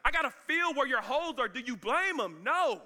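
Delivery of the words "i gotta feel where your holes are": high, insistent protest; lightly acted doubter demanding proof; doubting Thomas. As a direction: protest urgently in a raised voice. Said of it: "Thomas is like".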